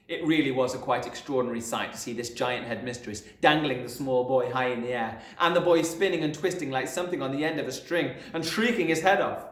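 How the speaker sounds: ordinary delivery, nothing marked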